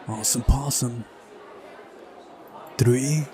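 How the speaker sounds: usual speaking voice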